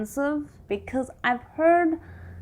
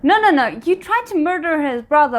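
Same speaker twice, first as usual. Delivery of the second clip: louder and higher no no no, indignant at the screen; loud protest; 5 semitones higher, 6 dB louder than usual. Real, not acted. Viewer yelling at the villain on screen, loud protest.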